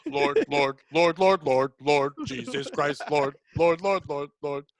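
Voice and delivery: low, repetitive mock invocation; pious lamenting character. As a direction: chant it low like a comic believer.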